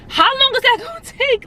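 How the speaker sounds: high-pitched incredulous question; alarmed disbelief. Retold or imitated. Patient questioning doctor about bare-handed reduction, high alarmed disbelief. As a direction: pitch jumps up, half laughing in disbelief.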